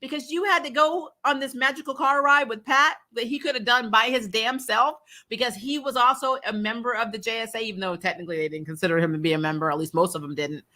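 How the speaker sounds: ordinary talk, nothing marked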